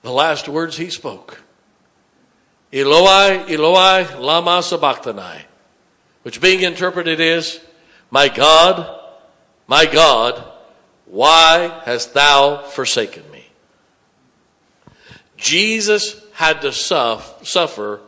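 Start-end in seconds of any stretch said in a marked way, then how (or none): 2.7-13.4 louder, pleading call to God; loud, pulpit-dramatized lament; anguished cry. Acted. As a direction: cry out as if forsaken. Jesus' cry on the cross.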